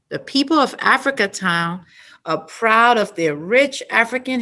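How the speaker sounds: loud, clear storybook read-aloud; reading to children. Acted. Teacher reading the Africa Town story to children, loud and clear. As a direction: read it out big for the whole class.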